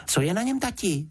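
put-on high, curious little girl; Juditka. A narrator's reading. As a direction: ask dad in a high child's voice. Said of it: "zeptala se Juditka".

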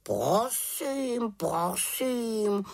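high, huffy raven voice; offended raven. A narrator's reading. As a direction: take offence in a stretched bird voice. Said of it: "urazil se Abraxas".

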